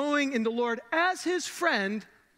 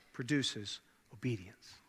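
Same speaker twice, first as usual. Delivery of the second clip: hushed whisper; 8 semitones lower, 8 dB quieter, slower than usual. Acted. Closing the circle softly.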